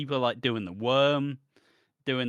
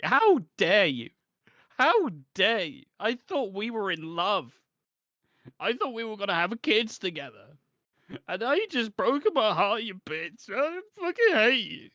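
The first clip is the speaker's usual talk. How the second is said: hammed-up heartbroken rant; 9 semitones higher than usual. Jilted man ranting at the woman who dumped him, high and hammed-up. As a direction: overplay a jilted man's outrage. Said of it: "Anthony's like".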